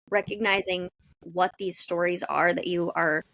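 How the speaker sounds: ordinary delivery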